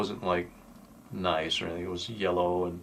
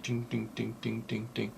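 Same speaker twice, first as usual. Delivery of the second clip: soft, hushed ding ding ding imitating metal bouncing; lug nut dings; 11 dB quieter than usual. Driver mimicking lug nuts flying off his car, soft imitated dings. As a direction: tap the dings out quietly, like nuts hitting asphalt.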